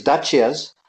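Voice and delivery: Dacias said the Romanian way, with a CH sound; Romanian pronunciation. Shown as a demonstration. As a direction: say Dacias as DAH-chee-uz, like Romanians. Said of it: "that's the way the Romanians pronounce it".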